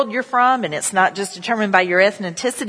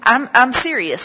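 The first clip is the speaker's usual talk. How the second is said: ordinary talk, nothing marked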